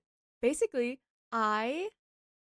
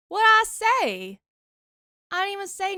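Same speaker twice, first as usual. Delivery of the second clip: indignant; 7 semitones higher, 10 dB louder than usual. Real, not acted. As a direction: protest your innocence loudly. Chat accuses her of lurking.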